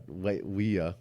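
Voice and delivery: "Leia" slipping out as LEE-uh; quietly fumbled "Leia", said LEE-uh; mispronounced name. Real, not acted. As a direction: start "Leia" with LAY, end up saying LEE-uh. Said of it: "Why do I keep saying that?".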